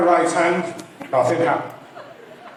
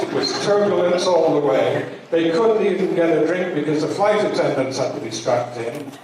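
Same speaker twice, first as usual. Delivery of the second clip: ordinary delivery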